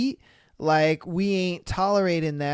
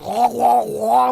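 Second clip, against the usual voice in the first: louder mock baby wail; mock crying wah; 2 semitones higher, 4 dB louder than usual. Streamer mocking the crying girlfriend, whiny wah. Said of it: "And she's just".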